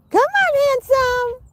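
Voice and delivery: high sing-song call; calling pets. Real, not acted. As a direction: coax them in a high voice.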